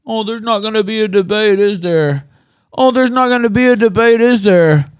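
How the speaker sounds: high, loud mocking sing-song of the chat; mocking whiny voice. Streamer mocking chat's complaints, loud whiny parody. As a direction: sneer it back at them like a whiner. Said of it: "I won't get my free".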